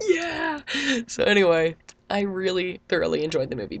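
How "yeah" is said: high cheer. Retold or imitated. Cheering at movie.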